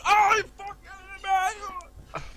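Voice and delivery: long, high, loud comic shriek; acted scream. Bystander shot by blind shooter, screaming in mock agony. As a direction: howl it out, drawn out and high.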